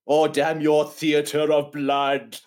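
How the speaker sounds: slow, drawn-out melodramatic outburst; hammed-up theatrical cursing; overwrought surgeon. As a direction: ham up the lament, stretching every word. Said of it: "that overwrought scene where he's go".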